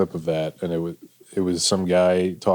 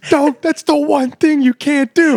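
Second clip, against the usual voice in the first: very high, loud, drawn-out yell; outraged producers; 6 dB louder, slower than usual. Acted. Producers scolding a contestant who described herself, hammed-up high yell. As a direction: shriek it slowly in mock despair.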